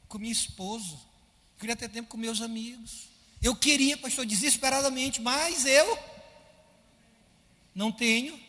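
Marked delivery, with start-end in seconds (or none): 3.4-8.4 anguished plea. Retold or imitated. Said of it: "Pastor, eu queria ter tempo".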